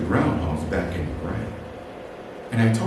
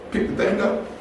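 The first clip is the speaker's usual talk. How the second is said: ordinary talk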